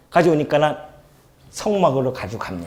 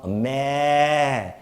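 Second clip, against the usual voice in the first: mock moo; much slower than usual. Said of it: "양소리인가".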